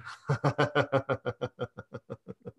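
long hearty laugh on cue; demo laugh. Shown as a demonstration. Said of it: "just start laughing".

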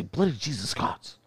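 mock British. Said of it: "They say".

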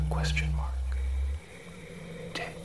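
punctuation breathed in a whisper; whispered dictation. Acted. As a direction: whisper the punctuation marks aside. Reading punctuation aloud.

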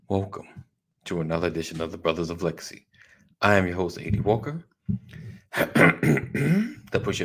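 announcer voice. Shown as a demonstration. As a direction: open the show like a radio host. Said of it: "Working on, working on my announcer voice".

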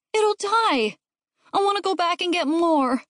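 put-on high-pitched wailing kid; whiny, high demand; distressed child. A narrator's reading. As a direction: plead like an upset child. Upset child pleading.

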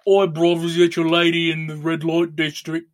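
light cockney "oi bruv" jab. Retold or imitated. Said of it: "why he always says "oi".

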